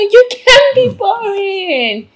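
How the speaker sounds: very high, loud string of denials; gushing protest. Acted. PA insisting the boss isn't boring, gushing protest. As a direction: protest in an excited, rising squeal.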